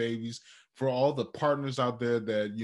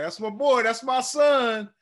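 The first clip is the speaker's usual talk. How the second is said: much higher, louder, slowing on son; proud dad gushing; 12 semitones higher, 8 dB louder than usual. Retold or imitated. Proud father gushing over his newborn son, high and loud. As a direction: beam with pride, pitched way up.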